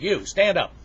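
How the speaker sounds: loud, high-pitched order to stand; stern teacher. Acted. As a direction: order the new pupil up sharply. Teacher puts new boy in place.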